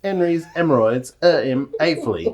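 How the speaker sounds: loud, hammed-up Cockney with no H's; broad, loud put-on Cockney; Cockney accent. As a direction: belt out the tongue-twister in mock Cockney. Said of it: "test of his Cockney accent".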